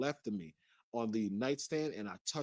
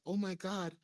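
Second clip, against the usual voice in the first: imitating her in a high-pitched voice; higher mock-innocent woman's voice; feigned innocence; 7 semitones higher than usual. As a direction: pretend to be surprised, pitched well up. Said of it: "she pretends like".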